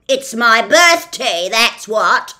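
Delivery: loud, slowish officious policeman; pompous policeman. Acted. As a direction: lay down the law with bluster. Policeman indignant.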